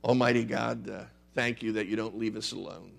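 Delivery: soft, reverent pastoral prayer; closing prayer. Real, not acted. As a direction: pray quietly for the congregation.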